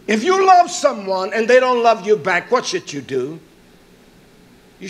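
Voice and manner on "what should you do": ordinary talk, nothing marked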